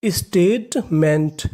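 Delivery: Statement drawn out very slowly, each part apart; slow syllable by syllable. Shown as a demonstration. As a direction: break statement into slow parts for learners. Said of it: "तो meaning बनी". (Statement को बहुत धीरे, अलग-अलग हिस्सों में)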